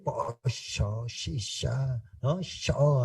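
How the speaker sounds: "Pollo" and "Sho" with a soft sh, said quietly; sh for ll and y, /posho/, /sho/; Argentine sh sound. Shown as a demonstration. A visitor imitating the Argentine sh sound. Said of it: "así".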